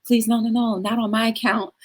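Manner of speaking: pretend reassuring spirit. Imagined self looking down on grieving mourners, playful pleading. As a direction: plead from above, playfully high-pitched. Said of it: "I look down and be like".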